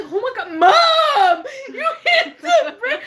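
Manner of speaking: hammed-up outrage over the brake; mock yell. To her mom after the sudden brake, a laughing mock yell.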